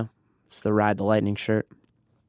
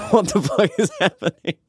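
mock bystander disbelief; 8 semitones higher than usual. Imagined bystanders reacting in disbelief.

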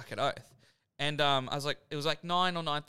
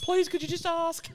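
mock begging; 13 semitones higher, 4 dB louder than usual. Retelling begging his mate.

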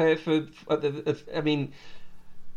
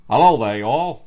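a much louder, hammed-up North Carolinian drawl on y'all; booming mock North Carolinian hello; North Carolinian man accent; 11 dB louder than usual. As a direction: go big with a Southern US greeting. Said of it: "Can you give it a go?".

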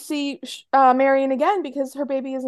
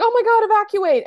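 higher, louder cry of alarm; retold alarm; 10 semitones higher, 6 dB louder than usual. [name] retelling a fire alarm, mock panicked cry. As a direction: shout the evacuation, mock panic. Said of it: "and she's like".

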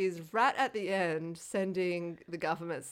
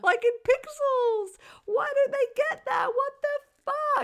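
much higher, slow and louder, squealing; squeaky mock outrage; 8 dB louder, slower than usual. Ranting about jumpsuits to her cohost, squeaky comic outrage. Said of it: "me to be like".

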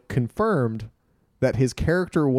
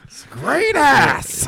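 very high, drawn-out comic shout; hammed-up yell, stretched out; movie outburst impression; 12 semitones higher, 5 dB louder, much slower than usual. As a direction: blurt GREAT long and high.

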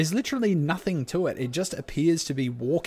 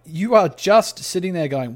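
usual speaking voice

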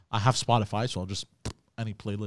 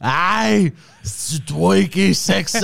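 loud, high, drawn-out French; over-the-top French come-on, slow and loud; exaggerated French accent; 6 semitones higher, 7 dB louder, much slower than usual. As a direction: ham up the French seduction line. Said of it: "You should have used the accent".

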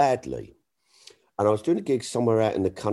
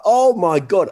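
high, loud disbelief; excited gasp; 10 semitones higher, 7 dB louder than usual. Retold or imitated. Reading the exit poll on the train, high and thrilled. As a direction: blurt it out, thrilled. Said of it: "I'm so excited".

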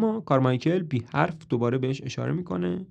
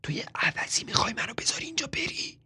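higher, angry whisper; 10 semitones higher, 6 dB quieter than usual. A narrator's reading. Marilyn whispering angrily at Toby so Carmichael won't hear. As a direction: hiss it secretly without voice.

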